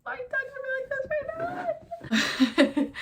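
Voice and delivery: high, loud, slow crying wail. Retold or imitated. Patient crying at boyfriend's sweet greeting. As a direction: sob it out through tears. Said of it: "and I was like".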